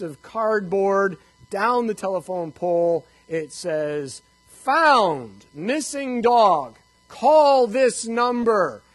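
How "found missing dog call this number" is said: loud, slow, like a sign; sign read out. Retold or imitated. Reading the found-dog sign aloud, loud and slow. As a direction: read each word as if written big.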